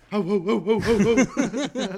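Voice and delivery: loud, high excited whoas; excited shouting. Real, not acted.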